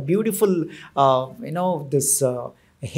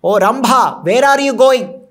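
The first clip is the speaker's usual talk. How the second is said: raised voice, higher and louder; forceful call; 5 semitones higher, 6 dB louder than usual. A narrator's reading. King stops Rambha as she walks by, forceful call. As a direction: halt them with a forceful call. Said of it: "immediately stop her forcefully".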